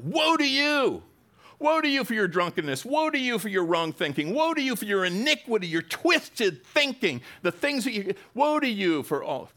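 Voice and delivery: high, loud prophetic proclamation; crying out woe. Retold or imitated. Prophet crying out woe to sinners, loud and high. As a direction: thunder woe at the people. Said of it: "he was crying out".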